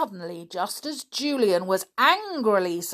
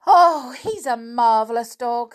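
admiring; 5 semitones higher, 8 dB louder than usual. Acted. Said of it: "said Anne".

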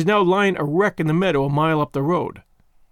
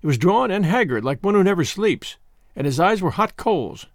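ordinary delivery

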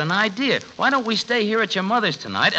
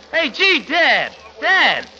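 higher, slow excited call; 8 semitones higher, much slower than usual. Acted. Son calling to his dad at the wheel, excited. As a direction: gasp it out, thrilled.

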